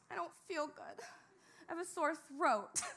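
mock whimpering complaint; sulky hammed-up whine to coworkers; whiny complaint. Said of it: "whiny Betsy".

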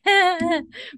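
high-pitched forced titter; fake nonchalant laugh. Claire edges away moving the chair, feigned nonchalant laugh. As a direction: mimic a nervous laugh pretending to be casual. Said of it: "trying to be nonchalant, but she's like".

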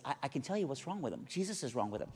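soft, unforced reply; calm assurance. Retold or imitated. Wife gently answers the lady.

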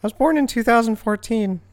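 put-on high, hesitant little kid; child voice. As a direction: talk like a small kid. Plays a six-year-old kid.

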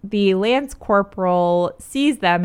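usual speaking voice

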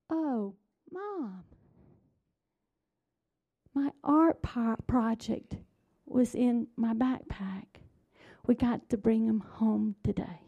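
lightly voiced hurt little girl, soft at first; retold crestfallen child. Little girl telling Mom about her art project, lightly imitated hurt.

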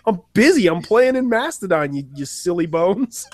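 high, goofy imitation of Brent; Brent impression. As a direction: raise the pitch and play it silly. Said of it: "And Brent is like".

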